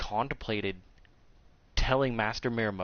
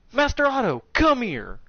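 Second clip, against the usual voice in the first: high, loud call across the room; imitated summons; 12 semitones higher, 5 dB louder than usual. Master summoning Otto in front of students, calling out. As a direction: call him over, raised voice.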